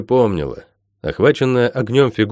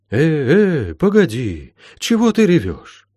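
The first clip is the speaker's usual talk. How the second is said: high-pitched, startled protest; flustered "hey, wait" rising high; taken aback; 7 semitones higher, slower than usual. A narrator's reading. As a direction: break in with surprised concern, jumping up in pitch. Healer startled by the girl crying.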